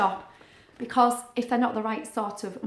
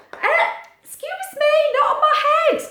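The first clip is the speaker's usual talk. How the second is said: high, loud squeaky protest; hammed-up outraged squeak; mock indignant; 8 dB louder than usual. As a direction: playfully object to the bop. Child bopping her head.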